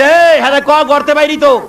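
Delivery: high, sharp scolding; angry father. Acted. Father losing temper at his crying son. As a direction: snap at the whining child.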